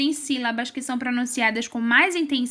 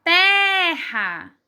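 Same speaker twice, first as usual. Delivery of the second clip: Terra pitched up, with a long strong first syllable; stress on TE; 4 semitones higher, 4 dB louder than usual. Shown as a demonstration. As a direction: hit the Te of Terra and hold it. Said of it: "É o té".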